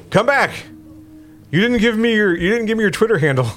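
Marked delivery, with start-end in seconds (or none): none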